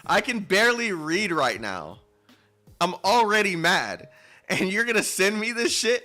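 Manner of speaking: very high, loud angry shouting; enraged shouting; furious yell. Real, not acted. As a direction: scream at the story in rage. Yelling at the writer.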